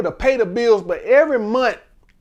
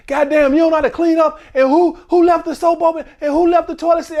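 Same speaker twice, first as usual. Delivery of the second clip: nagging rant, higher and rushed; man back from work nags his partner about chores, mock complaining; mock bitching; 7 semitones higher, faster than usual. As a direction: gripe at every little thing like a stressed man. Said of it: "just bitching over any goddamn thing".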